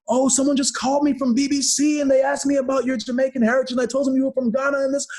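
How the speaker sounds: high, chattering mother on the phone; imitating his mom. Mother reporting a reporter's call to her son, in a high imitated voice. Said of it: "my mom calls me up".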